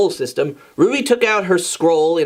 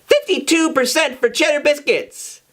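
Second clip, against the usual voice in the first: reading the poll; 10 semitones higher than usual. Acted. Ruby reads the poll result.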